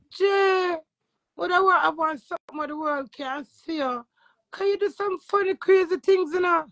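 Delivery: a hint of eager Tommy asking; quoting Tommy. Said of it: "And Tommy say".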